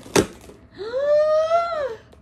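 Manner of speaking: high, loud sharp gasp of delight; loud excited gasp. Real, not acted. Opening a plant package.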